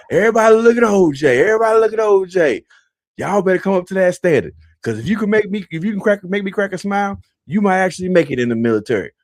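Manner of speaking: loud, high barking call to the platoon; sergeant addressing platoon. Retold or imitated. Drill sergeant shouting to the whole platoon. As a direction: hold him up as the example, loud. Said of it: "He said".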